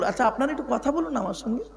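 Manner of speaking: ordinary talk, nothing marked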